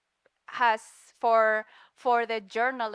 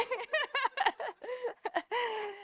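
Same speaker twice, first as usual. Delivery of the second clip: long high laugh; 11 semitones higher, 6 dB quieter than usual. Real, not acted. Recalling sister laughing and crying.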